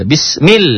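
"Bismil" pitched high and rising, L snapped. Shown as a demonstration. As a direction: hit "Bismil" high with a sharp L.

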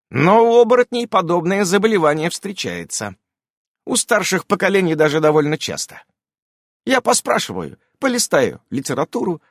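high, brisk witch voice; a busy witch promising a cure; high lively witch voice; witch Irene voice. A narrator's reading.

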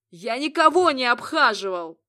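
loud angry denial; angry outburst. Acted. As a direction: slam it out in anger. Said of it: "ударил по столу Гадриэль".

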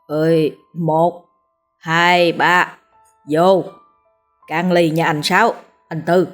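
slurring like a tipsy drinker; drawn-out drunken toast; drunk slurred voice. Acted. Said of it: "giọng lè nhè".

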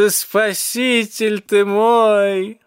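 high, loud, very drawn-out gushing; drunken cat's ecstatic cry; exclaiming. A narrator's reading. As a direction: gush it out slowly at full voice. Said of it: "воскликнул он".

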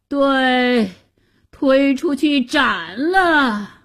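slow, drawn-out foolish royal order; muddled emperor's shout. A narrator's reading. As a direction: call for an execution in a dopey daze. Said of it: "皇上也迷迷糊糊地喊着".

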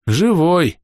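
plain reading voice

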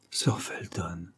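soft, low and coy warning; a modest, coquettish little caution; demure coquetry. A narrator's reading. Laure warns Sir Felton the chauffeur may hear, demurely coy. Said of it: "averti avec une coquetterie pudique".

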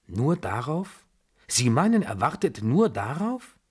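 can hardly believe it, pitch leaping up; incrédule. Acted. Incredulous question on hearing the news.

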